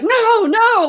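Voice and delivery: high, mock-frightened protest; alarmed refusal. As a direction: imitate a friend jumping away. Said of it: "like, he jumps away".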